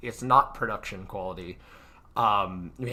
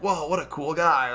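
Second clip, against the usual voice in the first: higher, impressed mock whoa; mock impressed viewer; 6 semitones higher, 4 dB louder than usual. Example chat reaction to viewers, mock impressed. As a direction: gush like a fan in chat. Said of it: "at certain points are like".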